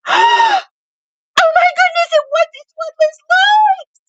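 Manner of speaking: very high-pitched imitation of a starstruck fan; fangirl squeal. Seller retelling Gigi John recognizing her, fangirl squeal. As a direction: squeal it like meeting your idol. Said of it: "Like a fangirl moment".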